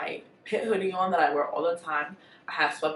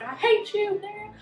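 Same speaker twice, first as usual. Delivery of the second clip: exasperated yelling; 14 semitones higher than usual. Retold or imitated. Exasperated reply to boyfriend.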